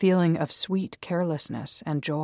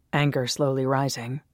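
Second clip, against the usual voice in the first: usual speaking voice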